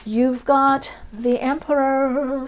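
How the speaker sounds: normal talking voice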